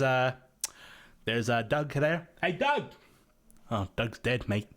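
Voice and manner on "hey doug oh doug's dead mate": lightly voiced Korg calling out; mock cheerful call then deadpan; Korg impression. Continuing the Korg bit to the listener, lightly voiced Korg. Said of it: "that Korg impression".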